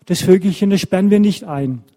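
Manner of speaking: storyteller's lightly acted insistent child; eager appeal to Mama. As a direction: voice an earnest boy appealing to his mother.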